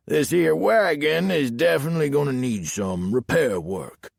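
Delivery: country lawman, starting high and falling away; Southern sheriff. A narrator's reading. Sheriff telling driver about the broken wagon, Southern sheriff voice. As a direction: pronounce the verdict as a Southern sheriff.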